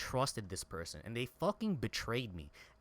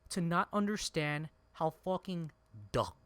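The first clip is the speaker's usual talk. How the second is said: normal talking voice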